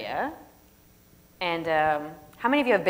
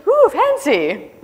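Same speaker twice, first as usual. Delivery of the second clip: mock impressed; 11 semitones higher, 10 dB louder, much slower than usual. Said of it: "And I would say".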